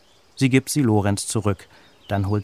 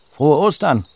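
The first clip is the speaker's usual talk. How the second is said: higher and loud greeting; cheerful call; 6 semitones higher, 9 dB louder than usual. A narrator's reading. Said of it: "ruft er". (lauter, hoher fröhlicher Gruß)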